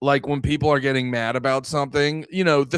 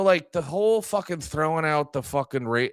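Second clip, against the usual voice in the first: ordinary delivery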